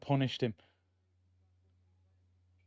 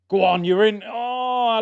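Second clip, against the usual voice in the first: excited match shout; 8 semitones higher, 8 dB louder, slower than usual. Real, not acted. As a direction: urge the player on, loud and high. Shouting at live match chance.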